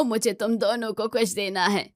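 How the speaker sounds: plain reading voice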